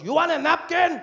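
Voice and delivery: very high, loud holler; taco truck vendor. Retold or imitated. Taco seller offering the customer a napkin, high shout. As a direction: yell it out as the vendor. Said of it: "So he goes".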